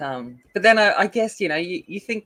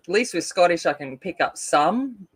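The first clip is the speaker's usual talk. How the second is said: ordinary talk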